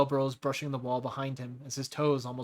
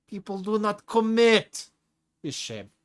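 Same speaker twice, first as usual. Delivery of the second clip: booming complaint; 8 semitones higher, 7 dB louder, slower than usual. Acted. Tigra's loud lament then drop.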